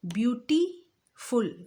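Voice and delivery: ब्यूटीफुल drawn out slowly, split into parts with a pause; slow demo of ब्यूटीफुल broken into syllables; syllables with a break. Shown as a demonstration. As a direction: stretch ब्यूटीफुल out piece by piece, not in one breath. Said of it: "ब्यूटीफुल मैं एक ही सांस में नहीं बोल सकती".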